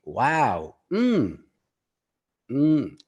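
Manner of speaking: wow, mm, aha, okay, nah in varied tones; tone of voice demo. Shown as a demonstration. Teacher showing his class tone of voice, varied interjections. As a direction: show how tone alone carries meaning. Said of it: "This is the tone of voice".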